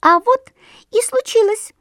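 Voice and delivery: very high, chirpy little bird voice; perky bird voice, high and light; chirping titmouse. A narrator's reading. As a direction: chirp it like a lively tit. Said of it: "чиликнула бойкая синичка".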